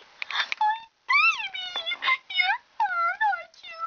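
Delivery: very high, drawn-out tearful wail; wailing mother. Acted. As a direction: sob it high and slow over your child. Mother wails over daughter.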